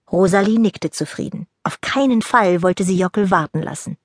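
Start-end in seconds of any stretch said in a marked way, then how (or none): none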